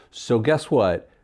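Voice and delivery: normal talking voice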